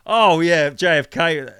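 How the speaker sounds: loud, keen blurt about JFK; mock eager conspiracy pitch. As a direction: burst out with the theory.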